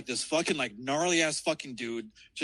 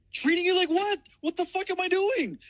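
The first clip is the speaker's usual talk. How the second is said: high, heated comeback; exasperated retort; 14 semitones higher than usual. Retold or imitated. Driver arguing back at his girlfriend, high-pitched exasperation. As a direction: fire it back in re-enacted indignation. Retelling his side of a quarrel.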